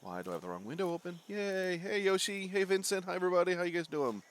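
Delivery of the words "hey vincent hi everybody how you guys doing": ordinary talk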